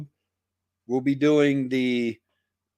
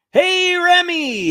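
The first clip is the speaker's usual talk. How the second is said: high, slow greeting; drawn-out happy hello; calling out; 6 dB louder, slower than usual. Real, not acted. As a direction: stretch out a welcome to a friend. Greeting a viewer arriving in chat.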